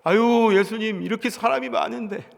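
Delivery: loud, high startled protest; crowd's flustered reply to Jesus. Acted. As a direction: blurt it out, caught off guard by the question. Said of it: "사람들이 깜짝 놀래요".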